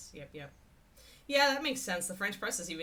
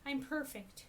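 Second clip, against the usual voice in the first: a hint of a higher, smug puppy voice; voicing the dog; 7 semitones higher, 3 dB quieter than usual. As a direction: lightly play the dog insisting she is perfect. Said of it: "she's like".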